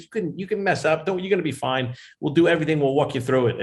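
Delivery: high-pitched imitation of a host calming a guest; Jay Leno, reassuring. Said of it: "he calmed me down".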